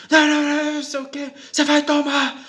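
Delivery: very high, loud mock outburst; possessed raging woman, imitated. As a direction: shriek threats as if the devil got into her. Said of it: "a mulher encarnou o cão lá comigo".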